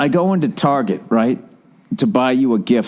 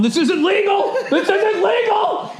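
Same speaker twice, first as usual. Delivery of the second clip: much higher mock shout, the second one stretched slow; retold desperate shout; slower than usual. Man dragged into an office by staff protesting, high drawn-out mock shout. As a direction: wail it out like a man being dragged off. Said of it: "And the guy's going".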